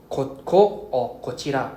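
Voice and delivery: ko-, ko or kochira sounded out slowly syllable by syllable; teacher slowly models kochira for the class, drawn out; slow careful kochira. Shown as a demonstration. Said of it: "we use".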